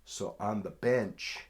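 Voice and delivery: ordinary talk, nothing marked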